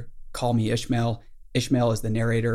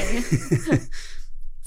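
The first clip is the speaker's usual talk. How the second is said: amused laugh; 13 semitones higher than usual. Real, not acted. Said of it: "He sounds a little cray".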